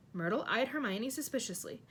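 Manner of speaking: plain reading voice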